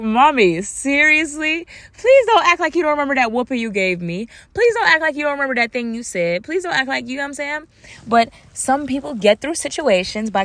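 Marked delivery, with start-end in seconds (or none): 0.0-7.1 higher-pitched, exasperated appeal to mom; exasperated pleading. Retold or imitated. Daughter confronting mom over her denial, exasperated pleading.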